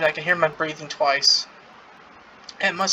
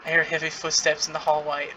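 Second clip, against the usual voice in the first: ordinary talk, nothing marked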